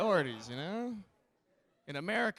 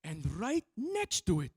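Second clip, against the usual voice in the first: put-on higher Italian-accented guide voice, proud; Italian tour guide; 6 semitones higher, 5 dB louder than usual. Comic imitating an Amalfi tour guide to tourists, Italian accent. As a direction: show off the tower like an eager Italian guide. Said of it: "the tour guide was just like".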